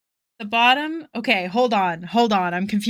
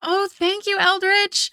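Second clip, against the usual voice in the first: bright thanks; 10 semitones higher, 5 dB louder than usual. Real, not acted. Thanking a supporter.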